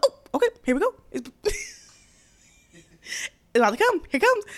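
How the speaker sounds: high, giggly, words breaking with laughter; laughing through words. Retold or imitated. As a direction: talk while cracking up. Retelling her reaction mid-laugh.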